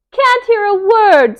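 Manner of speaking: high, loud squeal over the noise; screaming. A narrator's reading. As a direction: shout over the traffic. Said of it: "screamed Melissa".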